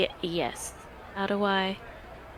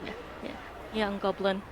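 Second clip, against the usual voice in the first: ordinary talk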